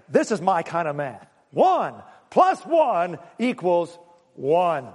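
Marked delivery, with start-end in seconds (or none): 1.5-4.9 slow emphatic. Acted. Preacher stresses math.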